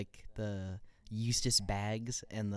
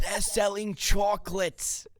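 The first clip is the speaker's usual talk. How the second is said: voicing a SpongeBob character; 10 semitones higher, 5 dB louder, much slower than usual. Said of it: "the chocolate lady".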